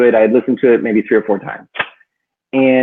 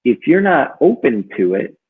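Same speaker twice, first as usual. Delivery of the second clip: ordinary talk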